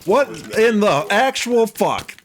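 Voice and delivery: high, slow disbelief; real shock at the spawner. Real, not acted. Mob spawner surprise.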